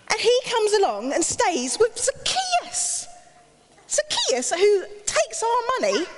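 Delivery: high, scornful, indignant crowd voice; grumbling crowd. Acted. Jealous crowd grumbling about Zacchaeus, high scornful whine. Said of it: "They started saying".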